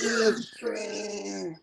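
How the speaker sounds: high, drawn-out singsong cry; catchphrase call. Pretend. Echoes the show's catchphrase.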